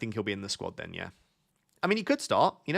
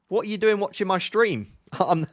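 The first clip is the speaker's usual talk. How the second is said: higher, louder, joking indignation with a laugh; mock outrage; 7 semitones higher, 3 dB louder than usual. Streamer teasing a top-ranked viewer, in mock outrage. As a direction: pretend to be offended, laughing through it. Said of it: "I'm only joking".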